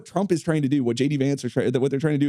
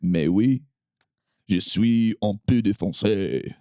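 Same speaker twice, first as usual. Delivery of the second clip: mock French; 5 semitones lower than usual. Jokey French banter continues.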